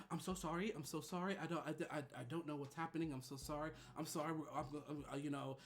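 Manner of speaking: soft, hushed, flustered apology; whisper-quiet sorry, trying to calm things; nervous apology. Retold or imitated. Receptionist apologizing to Rebecca, soft and flustered. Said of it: "She's like".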